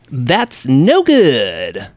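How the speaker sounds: slow, exaggerated cartoon-hero impression; Sonic the Hedgehog. Host quoting the old Sonic cartoon, cartoon impression. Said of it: "That was from Hit That Cartoon".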